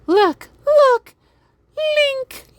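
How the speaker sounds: extremely high, playful squeak; high-pitched voice. Pretend. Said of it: "that high-pitched voice".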